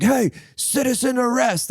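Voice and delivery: high, slow hammed-up cry; mock a busybody calling out, high and slow; mock citizen's arrest. Podcaster mocks a wannabe hero, hammed-up high call. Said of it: "somebody's just like".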